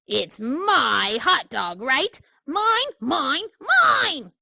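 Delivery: a greedy bird's tantrum; tantrum pigeon. Acted.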